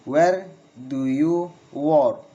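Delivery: very slow, word by word, "work" falling; slow falling question. Shown as a demonstration. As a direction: pause between chunks for learners. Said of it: "Failing intonation in with, in questions".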